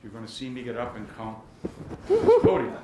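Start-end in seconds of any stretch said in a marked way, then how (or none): 2.1-2.8 booming, much louder and higher; playful demo of a booming voice; big voice. Shown as a demonstration. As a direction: boom it out to show the big voice. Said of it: "'cause I got a big voice".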